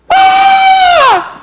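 high shrieking scream; terrified scream. Retold or imitated. Preacher acts out disciples seeing Jesus, terrified scream. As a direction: shriek in mock terror. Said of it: "just shaking".

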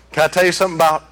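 usual speaking voice

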